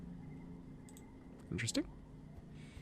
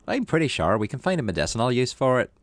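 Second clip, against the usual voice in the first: a light, raised cheerful dad; cheery dad voice; 4 semitones higher, 4 dB louder than usual. Acted. Dad greeting his kid with a new plant, lightly raised cheery voice. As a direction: read the dad a touch higher, friendly. Dad's line in game story.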